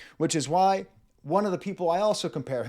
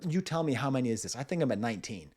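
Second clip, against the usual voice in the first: usual speaking voice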